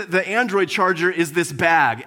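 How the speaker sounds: ordinary talk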